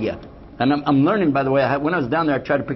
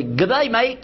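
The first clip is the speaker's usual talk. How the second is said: mock Australian "Good day, mate", pitched higher; cheery hammed-up Aussie greeting; Australian accent; 11 semitones higher, slower than usual. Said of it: "Anyway, they say".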